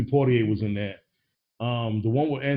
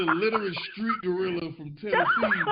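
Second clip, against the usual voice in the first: laughing; 6 semitones higher, 3 dB quieter than usual. Real, not acted. Mocking the film's portrayal.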